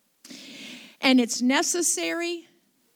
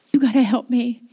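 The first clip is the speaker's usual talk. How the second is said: louder, insistent retold prayer; urgent plea; 9 dB louder than usual. Pastor begging the Holy Ghost again, loud and urgent. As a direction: press the plea home, loud. Same plea repeated with force.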